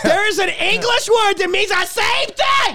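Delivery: incredulous, half laughing, pitch way up; stunned disbelief at the idea; exasperated laughing rant. Real, not acted. Exasperated rant rising loud.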